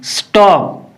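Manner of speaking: स्टॉप with a distinct स sound then ट; clear s then t. Shown as a demonstration. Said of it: "स की आवाज़ निकलनी चाहिए और उसके बाद में ट का साउंड". (स्टॉप में पहले स, फिर ट की आवाज़)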